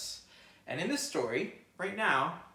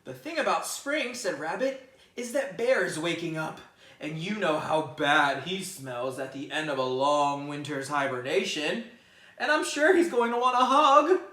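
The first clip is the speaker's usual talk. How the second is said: fretting rabbit; 2 semitones higher, 10 dB louder than usual. Acted. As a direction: dread a smelly bear hug. Rabbit dreading stinky Bear.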